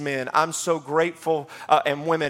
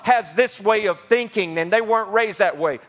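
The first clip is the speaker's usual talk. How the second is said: call-and-response preaching; 5 semitones higher than usual. Acted. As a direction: preach it like a drumbeat, question then answer.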